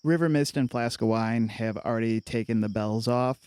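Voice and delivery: ordinary talk, nothing marked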